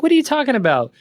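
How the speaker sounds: high-pitched disbelief, imitating himself; incredulous protest. Scene: boss retelling his reply to the advisor, high incredulous protest.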